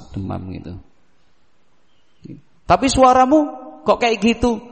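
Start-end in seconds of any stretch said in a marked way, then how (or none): 2.7-4.6 worried parent. Retold or imitated. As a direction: question the odd voice. Mother hears sick voice.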